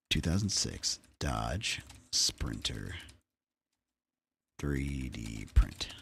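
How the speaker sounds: low, soft, slow word-by-word murmur; muttering to oneself. Real, not acted. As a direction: drag each word out quietly while typing a search. Talks to himself while searching.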